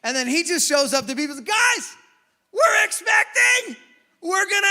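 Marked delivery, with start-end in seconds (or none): 1.4-4.7 high, loud, slow shout of joy; wife bursting out with the pregnancy news; excited announcement. Retold or imitated.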